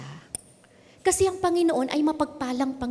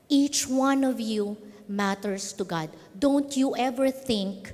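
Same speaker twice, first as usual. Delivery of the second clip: slow emphatic appeal; slower than usual. Real, not acted.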